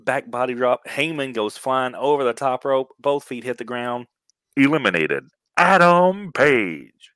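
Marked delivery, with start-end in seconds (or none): 4.6-7.1 booming drawn-out announcer call; ring announcer. Pretend. Ring announcer calling elimination.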